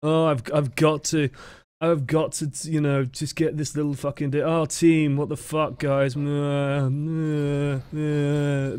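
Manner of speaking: drawn-out whiny moaning; put-on grumble trailing into wordless whines; passive-aggressive whining. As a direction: mimic a sulky, whining teammate on comms. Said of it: "passive-aggressive whining in voice chat".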